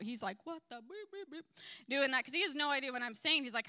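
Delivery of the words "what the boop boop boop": imitated bleeps, high and soft; self-censored cussing. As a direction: cover the retold swear with little high boops. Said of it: "he's cussing the whole time".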